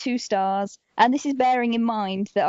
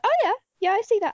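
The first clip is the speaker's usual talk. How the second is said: high-pitched, won-over financier; acted eager agreement; 10 semitones higher, faster than usual. Acting financiers warming to pitch.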